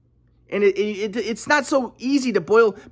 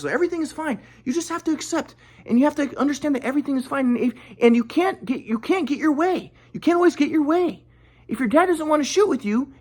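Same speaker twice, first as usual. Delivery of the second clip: higher, preachy mock-calm voice; mock guru grandpa; 6 semitones higher than usual. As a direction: parody the spiritual grandpa soothing. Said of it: "my grandpa's like".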